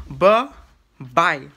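loud, drawn-out bye-bye split by a pause; exaggerated two-part sign-off, loud and separated; imitating Derek's sign-off. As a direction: punch out each bye slowly, falling at the end. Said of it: "He said".

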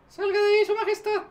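loud high scream to flee; panicked shout. Real, not acted. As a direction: yell for the queen to escape. Queen unit under attack.

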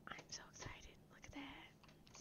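breathless whispered awe; excited whisper. Real, not acted. Whispering in awe at the city sights. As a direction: whisper it like a secret thrill.

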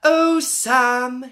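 put-on high, loud childish wail; hungry sister wailing at her brother. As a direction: cry out the name like a hungry kid. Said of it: "she wailed".

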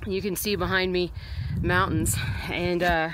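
breathless from running around; panting between words; out of breath. Real, not acted. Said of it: "sorry guys, I'm out of breath".

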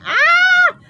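put-on a wild child's shouting game; screaming. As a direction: yell it gleefully while running. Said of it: "running around 'em and screaming".

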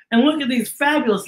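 usual speaking voice

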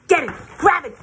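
high, loud get it, grab it; eager grab. A narrator's reading. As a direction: pounce on the words. Owl acts out trapping.

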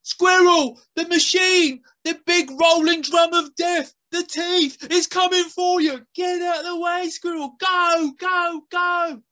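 loud, high shooing yell; boy yelling at the squirrel to move; shouting boy. A narrator's reading. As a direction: shoo the squirrel off the road. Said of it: "The boy tried harder".

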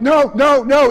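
mock warning at a movie screen; warning cry. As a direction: call out a warning. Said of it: "you're like".

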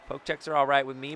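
ordinary talk

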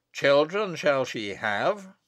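slow, weighty prophecy; prophetic. Acted. Abbot continues his curse on the child, prophetic. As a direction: intone it solemnly, each word drawn out. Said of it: "as if filled with a prophetic spirit".

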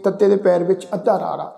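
ordinary talk

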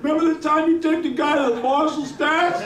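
high, eager calling voice; imitated excited fan. Stranger reminding the guitarist of the gig, excited. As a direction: gush it high, as if reminding an idol.

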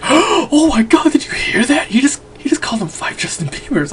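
high, gasping stage whisper; breathless high-pitched mock outrage, half whispered; shocked fangirl. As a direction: imitate a scandalized girl whispering to her friend. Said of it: "she's like".

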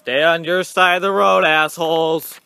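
loud shout from the bike; yelling. Real, not acted. Shouting at passing drivers while riding, loud. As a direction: bellow it at the traffic.

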